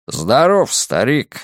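ordinary delivery

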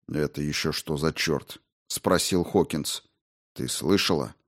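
lightly voiced man, low and soft; puzzled low question to a companion; Хокинс насторожен. Narrator voices Hawkins, startled question. (низким голосом, настороженно)

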